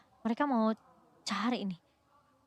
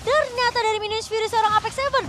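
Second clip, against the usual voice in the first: rising hype play-by-play, higher and louder; fighting game announcer; 9 semitones higher, 7 dB louder than usual. Acted. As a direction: call the big fight moment at full volume.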